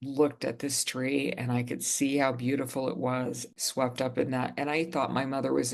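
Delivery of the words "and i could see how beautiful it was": usual speaking voice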